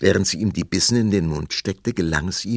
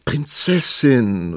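slow, louder call of her name; discouraged call; 6 semitones higher, 7 dB louder, much slower than usual. Acted. Prince calling the princess in dismay, mild discouraged call. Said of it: "rief der Prinz entmutigt".

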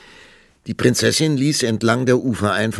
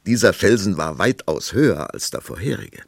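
ordinary delivery

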